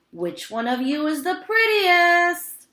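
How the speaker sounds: high, loud call to the cats. A narrator's reading. Old man calling out to the cats, high. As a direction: call out across the yard. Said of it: "he called to the cats".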